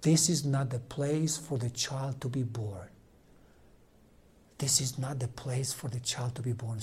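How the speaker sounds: soft, hushed repetition of his own words; quiet realization. Retold or imitated. A priest hearing his own complaint about the painting, now softly. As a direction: let it sink in quietly. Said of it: "My words were".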